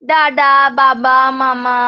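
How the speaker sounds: slow, emphatic teaching examples; slow example words. Shown as a demonstration. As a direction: give "দাদা" and "বাবা" as clear slow examples. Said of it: "bisyllable word যেগুলো".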